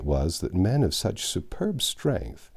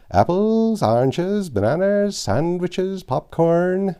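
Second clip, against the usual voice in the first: higher sing-song call of a station conductor and vendor; train conductor's sing-song calls; 7 semitones higher than usual. Retold or imitated. Remembered boyhood train announcer calling out, higher and sing-song. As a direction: chant the stops and the snacks like a railway hawker. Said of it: "the pelting sing-song of it".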